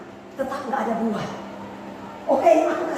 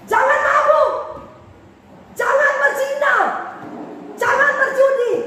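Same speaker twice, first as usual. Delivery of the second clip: shouted warning; 11 semitones higher than usual. Retold or imitated. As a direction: yell the warnings at the congregation. Pastor repeating his warnings. (teriakan peringatan keras dan tinggi)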